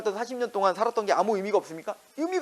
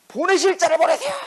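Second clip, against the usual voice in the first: Moses refusing; 7 semitones higher, 5 dB louder than usual. Retold or imitated. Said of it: "그런데 뭐라고요, 모세가?".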